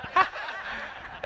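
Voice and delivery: high, airy retold chuckle; coach's laugh. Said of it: "And he starts laughing".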